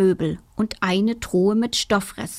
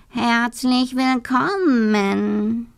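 very slow, drawn-out higher cat voice; 3 semitones higher, much slower than usual. Acted. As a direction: purr the words out like a cat. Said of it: "sagte ein pechschwarzer Kater".

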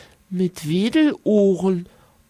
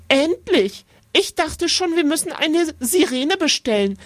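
sharp teacher; 8 semitones higher than usual. A narrator's reading. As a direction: snap at the sleepy boy. Teacher waking sleeping boy.

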